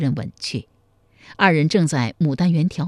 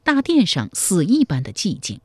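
plain reading voice

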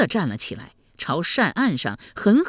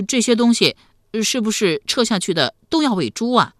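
put-on louder, higher boyish question; naive young nephew; 4 semitones higher, 4 dB louder than usual. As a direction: ask like an innocent, curious lad.

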